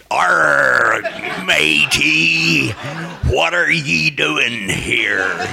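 high, slow growly pirate drawl. Retold or imitated. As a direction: ham it up like an old sea pirate. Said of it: "sounded just like a pirate".

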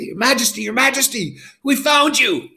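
very high, urgent calling; relieved exclamation. Acted. Guards find the king on the ground, high and relieved. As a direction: cry out in relief.

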